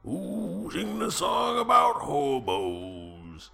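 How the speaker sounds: high, slow, raspy crooner growl. Acted. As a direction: croak it out like a boozy barroom singer. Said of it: "I can do a sort of a [name] accent".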